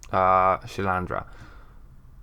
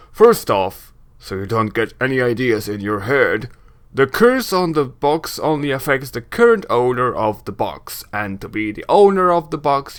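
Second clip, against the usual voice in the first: big, projected explaining voice; booming voice; 2 semitones higher than usual. Acted. Braskin explains the curse to the visitor, booming. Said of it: "doing the booming voice".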